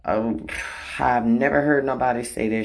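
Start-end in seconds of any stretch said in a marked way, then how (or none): none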